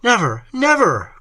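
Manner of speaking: high, loud outcry; crying out. A narrator's reading. A distraught lover refusing, loud and high. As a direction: refuse with all force. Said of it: "cried he".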